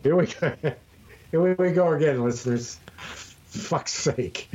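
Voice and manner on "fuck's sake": whispered curse. Real, not acted. Muttered curse.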